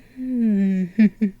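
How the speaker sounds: humming, closed-mouth chuckle; teasing chuckle. Acted. Princess hinting at sharing her bed, teasing chuckle. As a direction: hum into a coy little laugh.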